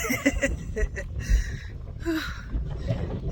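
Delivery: high laugh ending in puffs; breathless laugh. Real, not acted. Hiker winded in the dunes, breathless laugh. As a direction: laugh until the air runs out.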